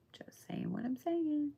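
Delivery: breathy teasing whisper aside; playful conspiratorial whisper. Real, not acted. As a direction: whisper it like a secret to the viewers.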